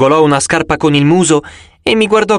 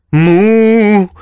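moo; 5 semitones higher, 4 dB louder than usual. Acted. Cow serpent speaks.

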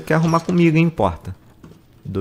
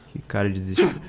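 ordinary delivery

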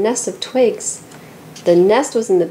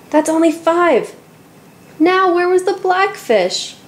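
put-on a worried little girl counting again; upset girl; 9 semitones higher than usual. A narrator's reading. Girl finds fish missing.

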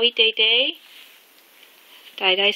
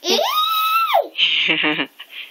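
elephant trumpet. Pretend.

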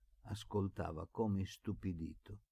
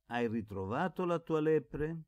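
calling out louder across the hillside; shouted; 5 semitones higher, 4 dB louder than usual. A narrator's reading. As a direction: call him from a distance. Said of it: "gridò Melchiorre".

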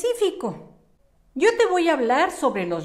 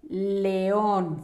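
very slow, drawn-out dictation, pitched near usual; 3 semitones lower than usual. Shown as a demonstration. Teacher tells pupils to write león, slow dictation. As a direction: stretch each word out for young copyists.

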